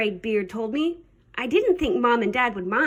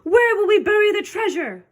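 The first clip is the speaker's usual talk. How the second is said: high, dismayed question; pirate holler; 8 semitones higher than usual. A narrator's reading. Captain frets about his treasure in the storm, hollering. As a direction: cry it out as a worried captain. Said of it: "hollered Braidbeard".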